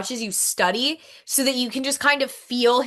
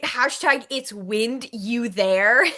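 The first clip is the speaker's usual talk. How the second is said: halting read of "wind you there", drawn out word by word; slow misreading; much slower than usual. Retold or imitated. Co-host showing how the hashtag read to them, slow misreading. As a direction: sound out "wind you there" slowly as separate words. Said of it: "I read it".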